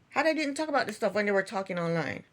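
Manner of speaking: normal talking voice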